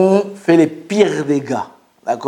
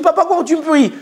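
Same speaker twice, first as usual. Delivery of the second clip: put-on protesting child; 9 semitones higher, faster than usual. Child protesting punishment to father.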